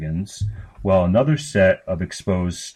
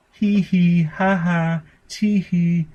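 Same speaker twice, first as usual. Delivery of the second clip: put-on high girlish giggles; 10 semitones higher, slower than usual. A narrator's reading. Sister giggling at her brother, high childish giggles. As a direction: giggle like a delighted little girl. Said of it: "burst into a fit of giggles".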